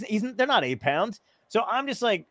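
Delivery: ordinary talk, nothing marked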